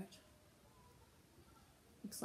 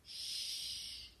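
soft, drawn-out shush; hushing; 5 dB quieter than usual. A narrator's reading. Character tells friends to listen, hushing. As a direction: hush them softly.

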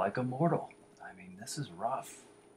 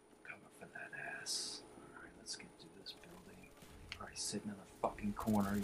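soft, voiceless repeat of the threat; whispered threat; 10 dB quieter, faster than usual. Real, not acted. Gamer whispers his threat while closing on Clown Baby. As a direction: whisper it as you sneak up.

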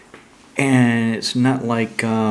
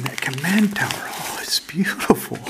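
hushed whisper, slowing down; awed whisper; 4 semitones higher than usual. Real, not acted. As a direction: breathe it out in wonder. Admiring a foil card.

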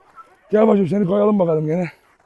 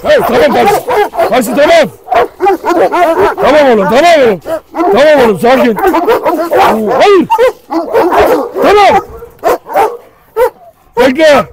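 high, loud alarmed shouts to break up the fight; alarmed yelling; 8 semitones higher, 5 dB louder than usual. Real, not acted. Owner shouts at fighting dogs in real alarm. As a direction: yell to stop the dogs, real panic.

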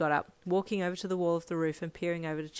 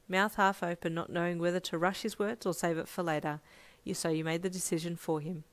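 plain reading voice

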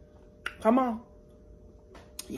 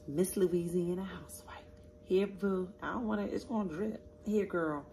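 whispered dedication; 10 dB quieter than usual. Real, not acted. Offering her sis the first bite, soft whisper. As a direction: whisper Miss Louisiana Housewife, then stay hushed.

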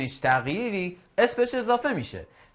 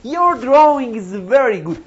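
very slow, louder and higher, your drawing stretched; slow model phrase; 8 semitones higher, 8 dB louder, much slower than usual. Shown as a demonstration. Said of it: "بعد من بگو". (آهسته و بلند: your drawing)

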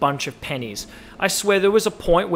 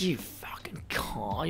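whispered insult; 3 semitones lower, 14 dB quieter, slower than usual. Shown as a demonstration. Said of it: "Instead of, like".

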